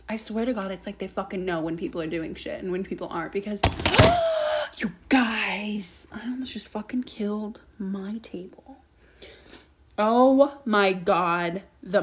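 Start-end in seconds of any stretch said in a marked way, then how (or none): 3.6-4.7 loud high startled gasp; sharp gasp. Real, not acted. Podcaster gasping after almost knocking over her table. 4.8-8.8 breathless whispered shock. Real, not acted. Podcaster whispering to listeners after a near accident.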